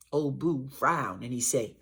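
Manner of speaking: usual speaking voice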